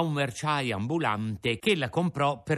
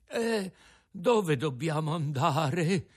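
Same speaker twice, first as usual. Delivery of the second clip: put-on slow, tired old father; faint old man; weary old Geppetto; 6 semitones higher, slower than usual. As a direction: ask as if barely able to stand. Domandò Geppetto.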